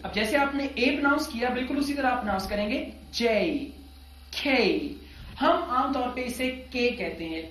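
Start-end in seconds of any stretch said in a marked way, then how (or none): 4.4-5.0 के as full kay glide; letter K model. Shown as a demonstration. Teacher models के for students to repeat. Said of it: "इस letter की pronunciation है के".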